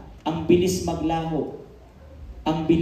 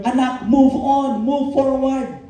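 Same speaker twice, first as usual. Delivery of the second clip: higher, slow, solemn urging; voice of God; 5 semitones higher, slower than usual. Acted. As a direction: urge the child onward as God. The Lord speaking to his child. (mataas at taimtim na tinig ng Panginoon)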